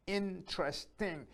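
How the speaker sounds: INTERESTING slow, in three beats; split into syllables. Shown as a demonstration. Teacher summing up interesting, split into syllables. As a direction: separate interesting into in, tres, ting. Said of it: "three syllables".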